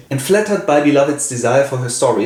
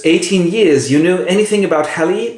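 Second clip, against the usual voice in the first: eager Seth; 7 semitones higher than usual. Acted. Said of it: "she goes".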